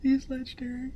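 very high squeaky gushing voice; tiny high cartoon voice, breathless with joy; squeaky fan. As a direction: gush like a starstruck little fan.

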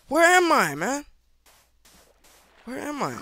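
high-pitched, rattled calling out; lost and starting to panic; alarmed. Real, not acted. Gamer lost in the game asks himself where he is, alarmed.

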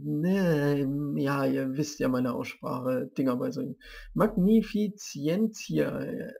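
ordinary talk

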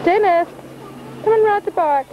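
calling out. Real, not acted. Teacher calling to a child.

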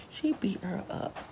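whispered "beat her up". Real, not acted. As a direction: hush it like gossip. Whispered aside.

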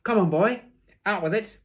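high, loud, impatient commands; parade-ground shout. A narrator's reading. Teacher pressing silent boy to confess, parade-ground shout. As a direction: yell as if at soldiers. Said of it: "as though I was a platoon".

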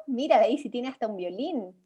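ordinary delivery

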